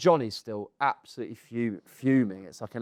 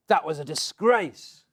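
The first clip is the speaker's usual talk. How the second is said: louder, higher, drawn-out rebuke; indignant outburst; 5 semitones higher, 4 dB louder, slower than usual. Retold or imitated. Spectator staring at batter before he leaves, indignant rebuke. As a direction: imitate an outraged spectator, stretching the words. Outraged spectator confronts him, acted.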